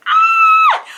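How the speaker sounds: a sudden high loud squeal of delight; excited squeal. Real, not acted. Vlogger to viewers about a romance book, a loud high squeal. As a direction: squeal like the book broke you.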